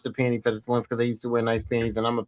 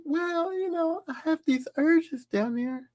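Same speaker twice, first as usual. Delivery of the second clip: shy daughter; 12 semitones higher, slower than usual. Retold or imitated. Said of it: "She's like".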